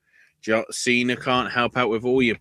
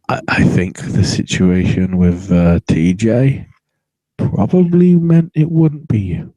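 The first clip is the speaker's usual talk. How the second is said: sarcastic retort; 3 semitones lower, 7 dB louder than usual. Retold or imitated. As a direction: fire back loudly with sarcasm. Sarcastic retort after "I'm like.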